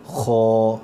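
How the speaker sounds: خو with a thick, rough sound from the back of the throat; not خا but a heavy خو; heavy kha. Shown as a demonstration. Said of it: "آواز تھوڑی سی موٹی ہونی چاہیے". (خو موٹی آواز کے ساتھ)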